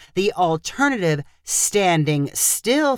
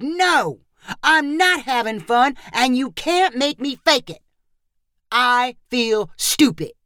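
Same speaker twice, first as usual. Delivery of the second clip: upset outburst; 6 semitones higher than usual. A narrator's reading.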